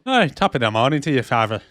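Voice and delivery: stage-Irish greeting, lightly; Irish accent. Retold or imitated. Burke greeting Irene, light Irish accent. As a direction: lay on the Irish cheer. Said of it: "Irish accent".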